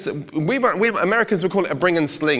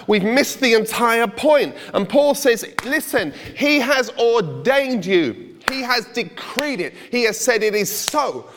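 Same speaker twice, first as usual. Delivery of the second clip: fervent sermon climax; preaching; 5 semitones higher than usual. Acted. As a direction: drive the point home from the pulpit. Preaching at full force.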